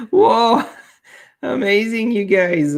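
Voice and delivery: high, loud delighted surprise; amazed. Real, not acted. Streamer greeting a family suddenly arriving, amazed. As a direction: burst out in real amazement. Friends suddenly flood the chat.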